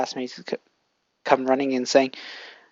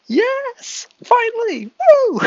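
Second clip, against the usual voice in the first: very high, loud squealing cheer; Lumi celebrating; 9 dB louder, much slower than usual. Acted. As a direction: shriek with joy at last. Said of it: "Lumi's celebrating".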